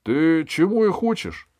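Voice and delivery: high, loud outraged question; indignant. A narrator's reading. King scolds the jester for his talk, indignant. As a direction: demand it indignantly. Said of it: "возмутился король".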